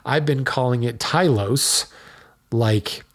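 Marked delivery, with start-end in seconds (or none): 1.0-1.9 "Tylos" in two syllables, last "es" dropped; mistaken pronunciation. Shown as a demonstration. Host admits his wrong way of saying it, mispronounced. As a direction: say "Tylos" as TIE-lohss. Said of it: "I have just been pronouncing it wrong".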